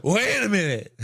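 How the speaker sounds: imitating a drunk fan, high-pitched; tanked fan. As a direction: put on a drunk guy's sudden recognition. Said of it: "he's tanked".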